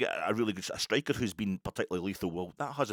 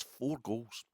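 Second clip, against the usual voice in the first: ordinary talk, nothing marked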